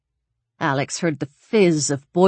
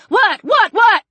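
high, loud, rapid-fire questions; alarmed shouting; 13 semitones higher, 6 dB louder than usual. A narrator's reading. Sharon reacts to Alex fleeing the skillet, shouting in alarm. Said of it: "Sharon was shouting".

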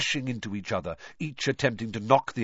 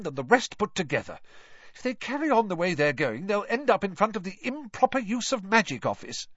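high-pitched worried scolding; exasperated mother's tirade; irritable; 8 semitones higher than usual. A narrator's reading. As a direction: keep grumbling crossly, pitch raised. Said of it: "she continued irritably".

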